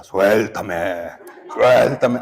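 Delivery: imitated possessed child, drawn-out growly demand; suddenly changed voice. Father imitates his screaming son's changed voice, eerie drawn-out demand. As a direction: stretch it out like one possessed. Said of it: "de pronto mi hijo cambió la voz".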